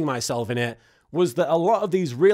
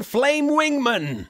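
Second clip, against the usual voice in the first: dramatic announcer; 6 semitones higher, much slower than usual. Pretend. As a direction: boom out "Flame Wingman" like a trailer voice. Announcing the summoned monster.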